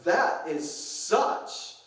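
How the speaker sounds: slow, drawn-out mock SoCal teen; valley girl drama. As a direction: stretch out "un-Lutheran" with teen outrage. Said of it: "she's really playing it up".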